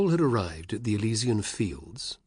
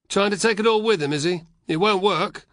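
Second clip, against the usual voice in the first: higher, louder jeering legionary; mocking soldier; 11 semitones higher, 7 dB louder than usual. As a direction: scoff at the rich lawyer.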